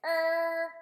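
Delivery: "Er." said with a smile, clear bunched R; modelled R sound. Shown as a demonstration. Therapist modelling "Er." for a child, smiling clear R. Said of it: "Just pull your tongue back and say".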